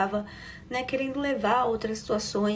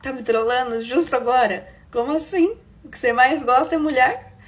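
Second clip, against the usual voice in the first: laughing disbelief; 7 dB louder than usual. Retold or imitated. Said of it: "Comecei a rir".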